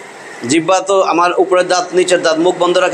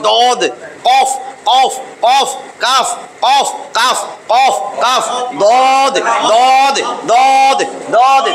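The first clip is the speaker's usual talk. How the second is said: দদ and কফ said with force, higher; forceful letter drill; 4 semitones higher, much slower than usual. Shown as a demonstration. Teacher drilling the back-tongue letters forcefully. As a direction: hit each letter hard from the back of the tongue. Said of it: "জোর দিয়া বলা লাগবে".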